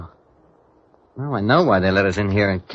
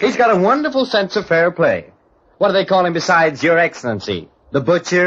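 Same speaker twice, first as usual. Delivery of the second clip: sarcastic taunt; 6 semitones higher than usual. Acted. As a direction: sneer at him with heavy irony. Larry taunts the duelist.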